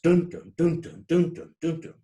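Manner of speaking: each first "dun" loud, each second "dun" weak; stressed-unstressed beat. Shown as a demonstration. Lecturer demonstrates meter, "dun, dun" pulsing strong then weak. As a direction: chant "dun, dun" in regular strong-weak pairs. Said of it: "It's going stressed, unstressed".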